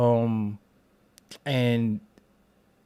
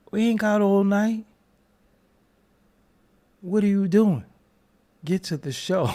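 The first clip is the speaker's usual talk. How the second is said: impatient high voice; 9 semitones higher, 4 dB louder than usual. Acted.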